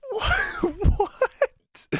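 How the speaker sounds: high laughing shout of shock; laughing disbelief. Real, not acted. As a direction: crack up in disbelief. Reacting to a shocking twist.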